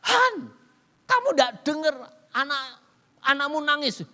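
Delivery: wife shouting to her husband who is watching TV; wife calling out. Retold or imitated. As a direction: call him sharply from the kitchen. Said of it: "istri saya setia".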